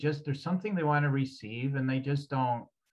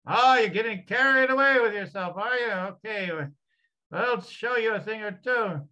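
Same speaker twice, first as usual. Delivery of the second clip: higher and louder, scolding challenge; feisty Irish energy; 8 semitones higher, 6 dB louder than usual. Acted. Healer voicing the Irish energy that scolds the client, higher and louder. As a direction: square up and tell them off. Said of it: "back to more of the Irish".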